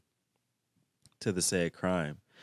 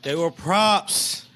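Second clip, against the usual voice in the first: sarcastic jab; 9 semitones higher, 7 dB louder, much slower than usual. Real, not acted. Mocking the witness's answer.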